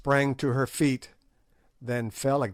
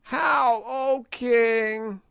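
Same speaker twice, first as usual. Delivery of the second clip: high, cracked crone's voice, slow and gloating; high sneering witch; old witch voice; 12 semitones higher, 3 dB louder, much slower than usual. A narrator's reading. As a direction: play the ancient hag taunting the king. Gagool mocks again.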